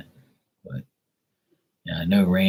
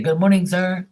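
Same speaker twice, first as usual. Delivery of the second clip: high-pitched mock Filipino accent; 12 semitones higher, 6 dB louder than usual. Pool guy greeting his customer in the morning, mock Filipino accent. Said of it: "That's how he talks".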